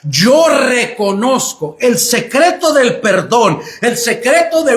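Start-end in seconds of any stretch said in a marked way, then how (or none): 0.0-1.8 slow, "Yo" pressed at the start; stress on yo. Shown as a demonstration. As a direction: lean on "Yo", then let "reconozco" fall. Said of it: "aquí vuelve una vez más a enfatizar". (recalcando "Yo", despacio)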